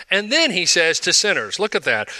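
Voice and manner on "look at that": ordinary talk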